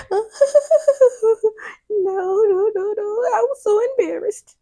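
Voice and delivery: high, loud laughing protest; laughing embarrassed no. Retold or imitated. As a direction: laugh through the denial. Laughing imitation of Sabrina.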